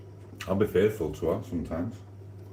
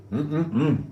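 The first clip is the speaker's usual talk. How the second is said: savoring hum; 8 semitones higher than usual. Real, not acted. Savouring sausages on stream.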